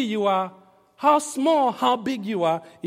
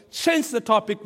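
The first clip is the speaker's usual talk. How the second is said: ordinary talk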